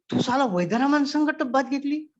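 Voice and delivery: high, loud, disbelieving outburst; can't believe his ears; incredulous cry. Retold or imitated. Neighbour's disbelief at the bathtub. (अविश्वासाने उंच आवाजात ओरडून)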